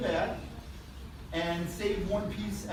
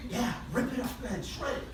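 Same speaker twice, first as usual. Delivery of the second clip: excited counting; 7 semitones higher, 3 dB quieter than usual. Acted. Magician counting up fast and high.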